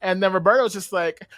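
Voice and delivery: ordinary talk, nothing marked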